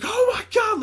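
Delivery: high, loud mock-panicked cry; freaking out. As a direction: imitate her panicked shriek. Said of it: "she started freaking out".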